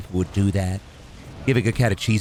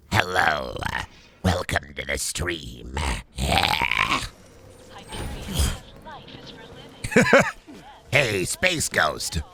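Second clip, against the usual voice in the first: much lower, slow gravel-free villain drawl; mocking cartoon villain greeting Space Ghost; Zorak voice; 4 semitones lower, 4 dB quieter, slower than usual. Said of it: "Hate how good your Zorak is".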